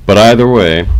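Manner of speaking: startling volume jump; sudden loud blast. Real, not acted. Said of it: "Sorry for that jump scare".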